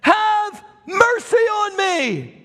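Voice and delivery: high, slow, drawn-out shout for mercy; desperate scream. Acted. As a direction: cry it out with everything. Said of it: "He begins to scream".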